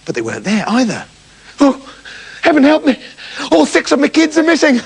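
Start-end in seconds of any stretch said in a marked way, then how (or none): 1.6-4.8 mother goat in a panic; frantic mother goat. Acted. Distraught mother goat cries out.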